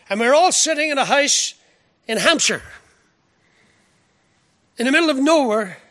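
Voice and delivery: a pulpit voice climbing in pitch; rising preaching. Acted. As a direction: lift the congregation with growing fervour. Preaching builds to full force.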